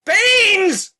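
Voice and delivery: high, loud repeat of Beans!; Beans! yelled up the pipe. Acted. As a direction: shout Beans! again so she hears. Repeated louder.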